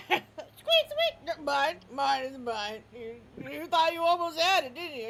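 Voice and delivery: high, loud teasing; a dog showing off the toy it won't give; loud gloating dog; taunting dog. Acted. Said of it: "he's like".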